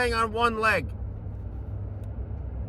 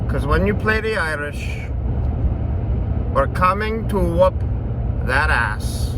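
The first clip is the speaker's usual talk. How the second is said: put-on slightly shaky Irish accent drifting toward other accents; 3 semitones lower than usual. Betting host taunts Irish opponents in an Irish accent. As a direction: recite the limerick in a rough Irish brogue. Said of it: "My Irish accent kinda went into Middle Eastern".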